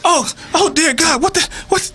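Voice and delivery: very high, wailing mock horror; horrified protest. Bird being shit on by Mister Ed, horrified protest.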